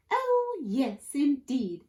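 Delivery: very high, drawn-out prim reply; proper-spoken rascal. A narrator's reading. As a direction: agree far too daintily. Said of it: "replied the rascal".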